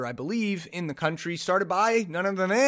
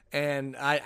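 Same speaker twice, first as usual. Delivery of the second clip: ordinary talk, nothing marked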